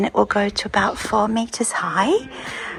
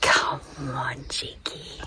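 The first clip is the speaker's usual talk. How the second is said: whispering on the phone; 7 semitones lower, 9 dB quieter, much slower than usual. Real, not acted. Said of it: "I have to whisper".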